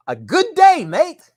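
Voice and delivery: loud, high-pitched mock Australian accent. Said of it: "that's the best ox-, accent you're ever gonna get from me".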